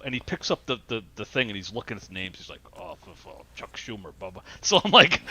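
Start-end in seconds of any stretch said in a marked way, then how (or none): 2.8-4.4 much softer, grumbling under the breath; muttering. Retold or imitated. Old voter grumbling at the ballot names, muttering. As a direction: mutter it to yourself in disgust. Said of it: "muttering to himself".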